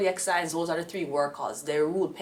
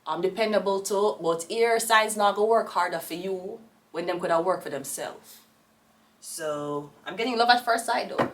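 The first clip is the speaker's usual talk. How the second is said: normal talking voice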